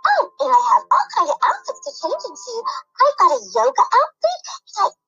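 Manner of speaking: put-on high, bright girly doll voice; little doll girl. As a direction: chirp it like an eager little doll. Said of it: "the little doll girl said".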